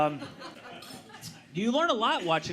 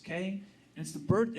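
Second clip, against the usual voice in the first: ordinary talk, nothing marked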